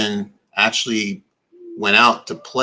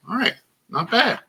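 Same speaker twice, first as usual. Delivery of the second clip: mock impressed voice; 13 semitones higher than usual. Mock approval voice.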